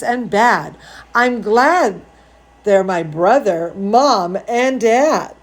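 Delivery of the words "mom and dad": usual speaking voice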